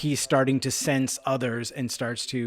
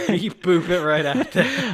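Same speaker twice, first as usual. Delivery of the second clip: laughing; 6 semitones higher, 7 dB louder, slower than usual. Real, not acted. Joking about multiverse group text.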